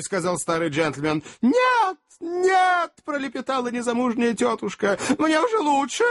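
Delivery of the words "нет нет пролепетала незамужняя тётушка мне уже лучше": high, frantic, breaking into mad laughter; hysterical babbling. Acted. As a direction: babble in hysterics, then cackle. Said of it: "припадок ... истерического смеха вперемешку с воплями".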